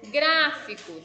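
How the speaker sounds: Gráfico with a loud "grá"; stress on first syllable. Shown as a demonstration. Teacher classifying the word for pupils, loud and emphatic. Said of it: "o gra é a sílaba mais forte".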